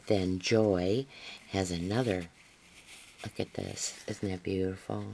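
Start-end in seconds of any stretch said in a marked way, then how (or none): 3.2-5.0 soft, admiring murmur; quiet marvelling over the card; hushed awe. Real, not acted. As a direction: say it softly, taken with the beauty. Showing a gift card in awe.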